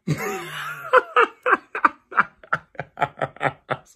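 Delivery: loud, high-pitched cackling; helpless laughing fit. Real, not acted. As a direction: burst out laughing and keep going. Cracks up at own whisper. (hard in lachen uitbarsten)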